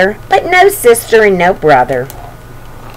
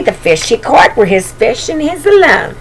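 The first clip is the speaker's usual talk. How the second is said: plain reading voice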